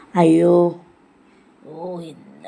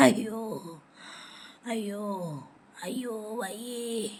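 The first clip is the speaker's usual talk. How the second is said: ailing moan; 3 semitones lower, 13 dB quieter than usual. Acted. Grandmother alone with her aches, ailing moan. Grandmother moaning that she is unwell.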